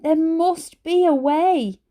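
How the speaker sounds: high, slow pleading. A narrator's reading. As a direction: beg her slowly. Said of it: "pleaded Ben".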